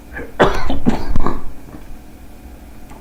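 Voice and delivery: sharp involuntary hiccup; a real hiccup jolting out. Real, not acted. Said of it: "I started hiccuping".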